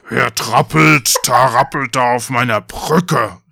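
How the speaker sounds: slow, loud troll growl; drawn-out monster challenge; troll calling out. A narrator's reading. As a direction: roar it slowly as the troll. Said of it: "rief der Troll".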